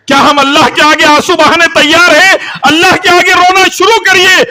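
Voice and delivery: high, loud preacher's cry; impassioned shouted appeal, easing at the close; fiery exhortation. Real, not acted. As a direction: call the congregation to act at full force. Exhorts the congregation.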